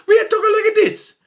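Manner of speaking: high, loud cartoon Italian accent; hammed-up Italian accent. Insisting on how Romans should talk, mock Italian accent. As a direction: burst back into exaggerated Italian-accented English. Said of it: "They need Italian accents".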